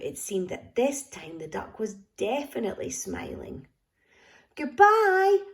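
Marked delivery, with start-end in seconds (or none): 4.6-5.4 high, loud goodbye; called out. A narrator's reading. Gumboot calling goodbye to the little girl, a high, loud call. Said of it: "called Gumboot".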